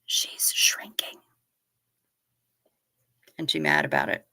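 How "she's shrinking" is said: slow whispered aside; a slow, hushed confession. Real, not acted. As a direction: whisper it as if she might hear. Sad aside about mom.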